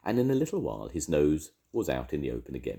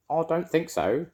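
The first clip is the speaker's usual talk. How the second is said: high put-on voice; disguised voice; 13 semitones higher, 4 dB louder than usual. A narrator's reading. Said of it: "in a different sort of voice".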